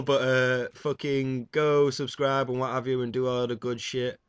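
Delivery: normal talking voice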